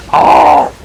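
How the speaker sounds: sudden high-pitched loud "oh"; high loud exclamation. Pretend. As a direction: burst out a high "oh". Caps the voice demonstrations.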